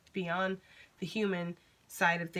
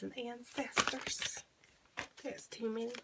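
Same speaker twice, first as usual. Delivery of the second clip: whispered; 4 semitones higher, 7 dB quieter, slower than usual. Real, not acted. Calling spirits, whispered.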